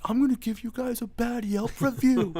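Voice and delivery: high, loud mock-drunk threat; drunk customer. Bouncer's roommate retells a drunk guy threatening the bar, high mock-drunk shout. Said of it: "this guy was so drunk".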